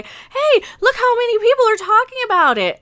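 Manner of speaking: high, sing-song boast about all the buzz; mock the proud studio, way up in pitch. Vlogger mocking studio execs bragging about hate clicks, high sarcastic boast.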